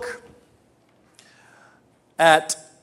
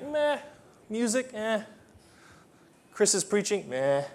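higher, unimpressed meh; bored churchgoer; 6 semitones higher, 6 dB quieter than usual. Retold or imitated. Bored churchgoer dismissing the service, high meh. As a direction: shrug it all off.

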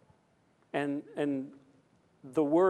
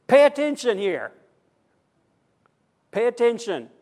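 high, loud call to listen; urgent command; 8 semitones higher, 8 dB louder than usual. Acted. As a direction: demand their attention from the pulpit. Preacher voices God's urgent call.

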